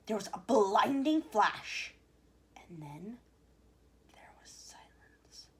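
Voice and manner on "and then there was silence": whispered, very soft, slow; hushed whisper. A narrator's reading. Narrator hushing after the flash. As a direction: drop to a whisper for the silence.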